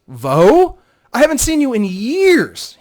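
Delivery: high, loud, slowed delighted disbelief; as if an old friend just walked in; surprised recognition. Acted. Talon recognising Vo after years, loud and astonished.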